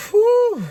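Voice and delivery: loud exasperated whew; big weary exhale. Real, not acted. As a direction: blow out the frustration. Exasperated exhale after rant.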